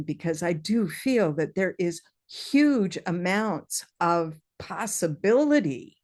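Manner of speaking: normal talking voice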